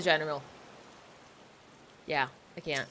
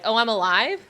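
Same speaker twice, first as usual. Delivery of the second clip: deadpan sarcasm; 4 semitones higher, 5 dB louder than usual. Retold or imitated. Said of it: "a millennial mindset".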